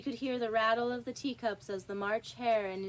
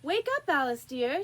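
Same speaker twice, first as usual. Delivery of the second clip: higher, louder gentle call; sister calling; 5 semitones higher, 6 dB louder than usual. Acted. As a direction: call her out of sleep. Sister wakes Alice.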